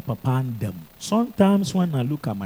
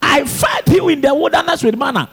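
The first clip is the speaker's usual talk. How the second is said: loud, high, shouted preaching; preaching at full force; 11 semitones higher, 6 dB louder than usual. Acted. Pastor shouting God's promise to his congregation. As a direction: proclaim it, voice soaring over the congregation.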